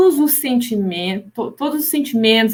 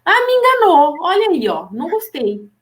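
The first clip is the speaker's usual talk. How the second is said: mock angry outburst; 6 semitones higher than usual. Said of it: "você fica com raiva".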